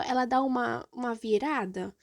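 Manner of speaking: ordinary delivery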